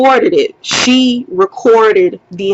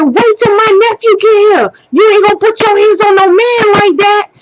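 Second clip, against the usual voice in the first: high, heated warning; angry threat; 10 semitones higher than usual. Retold or imitated. Woman threatening him with her nephew's arrival, angry. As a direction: warn him off at a high, insistent pitch.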